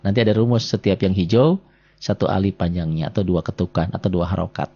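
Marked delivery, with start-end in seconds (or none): none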